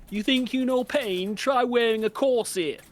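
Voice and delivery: high, loud, haughty princess line; mock snooty princess voice; bitchy princess quote. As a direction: toss it off like a spoiled princess. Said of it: "bitchy, princessy".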